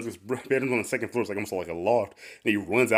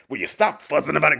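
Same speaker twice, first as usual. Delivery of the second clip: louder, higher mock exasperation; annoyed dismissal; 5 semitones higher, 6 dB louder than usual. [name] to his fretting husband, mildly exasperated. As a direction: brush off the fuss with light irritation. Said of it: "Wally's just making a big deal".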